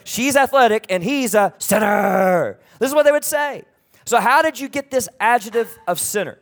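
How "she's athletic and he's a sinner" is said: loud, drawn-out jeer of a label; mock accusing shout. People label someone a sinner, mock accusing shout.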